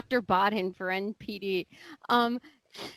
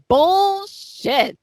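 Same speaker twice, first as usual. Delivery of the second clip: high, drawn-out mock shout; hammed-up cry of disbelief; retold outburst; 9 semitones higher, 4 dB louder than usual. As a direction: call it out high and long. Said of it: "And Artie's like".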